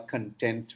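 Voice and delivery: "content" as con-TENT within the sentence; second-syllable stress. Shown as a demonstration. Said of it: "stress moves from first to the second".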